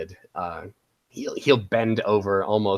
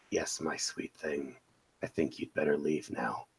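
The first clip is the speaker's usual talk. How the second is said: low, soft, intimate dismissal; soft, lowered voice of a tender seducer; seductive character voice; 4 semitones lower, 6 dB quieter than usual. Acted. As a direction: drop low and quiet, gently commanding. Player voices Kel to her.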